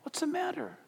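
a hushed puzzled ask; concerned murmur. Retold or imitated. People asking the weeping Jesus, quiet worry. Bystanders notice Jesus crying.

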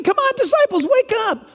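high playful "come on, wake up"; urging wake up. Retold or imitated. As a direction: rouse the sleepy disciples. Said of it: "Jesus is saying".

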